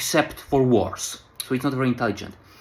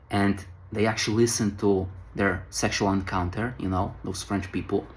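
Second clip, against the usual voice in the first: usual speaking voice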